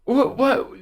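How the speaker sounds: high-pitched, stammered confusion; baffled. Retold or imitated. Moviegoer thrown by an action movie, baffled. Baffled reaction to the film acted out.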